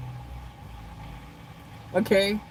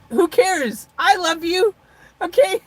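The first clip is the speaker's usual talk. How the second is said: high, loud playful diva rant; mock self-centred; 7 semitones higher, 4 dB louder than usual. Vlogger teasing her live chat, mock self-centred. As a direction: ham up being all about yourself. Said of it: "in the most comical way possible".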